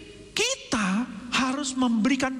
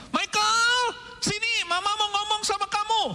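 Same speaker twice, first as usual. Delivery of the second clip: mom calling her son; 12 semitones higher than usual. Retold or imitated. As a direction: call the child like a mother across the house. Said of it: "misalnya kakak Michael ya".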